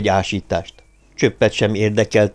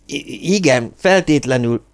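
higher, flustered stammer; 5 semitones higher than usual. A narrator's reading. Hírek flustered by the captain's alarm, stammering. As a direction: trip on the first sound, then agree hastily. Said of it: "hebegte Hírek".

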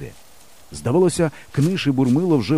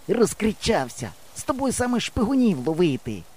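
higher-pitched, restrained scolding; hissing through teeth; 8 semitones higher than usual. A narrator's reading. Yava scolds his noisy friend, hissing through teeth. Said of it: "сичить крізь зуби Ява".

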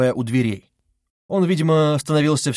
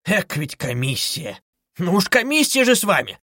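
merry, sly cry; 6 semitones higher than usual. A narrator's reading. Investigator fussing over impatient suspect, merry slyness. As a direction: exclaim with sly good cheer. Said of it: "вскричал Порфирий с совершенно весёлым, лукавым".

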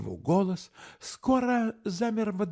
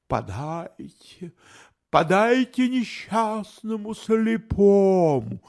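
beggar's call; 3 semitones higher, 8 dB louder, slower than usual. A narrator's reading. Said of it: "вдруг произнёс он".

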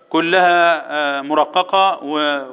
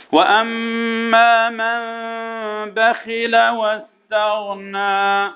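very slow, higher sustained chant; Quran recitation; 4 semitones higher, much slower than usual. Shown as a demonstration. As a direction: intone the verse with long held nasals. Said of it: "الاحكام هنا".

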